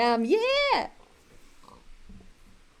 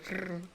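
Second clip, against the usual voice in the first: low, soft throaty growl; quick little mock growl; imitated growl; 4 semitones lower, 11 dB quieter than usual. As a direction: growl quietly like the contestant. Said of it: "And then she'll growl. She'll go".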